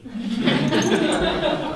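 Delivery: a sudden high, breathless laugh through tears; tearful laugh. Real, not acted. Student speaker laughing at himself for crying on stage. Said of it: "I'm bawling".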